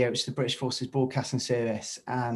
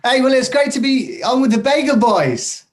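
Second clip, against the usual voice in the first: excited greeting; 11 semitones higher, 9 dB louder than usual. Real, not acted. Loud excited radio greeting.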